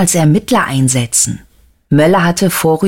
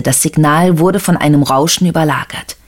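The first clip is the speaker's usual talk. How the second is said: usual speaking voice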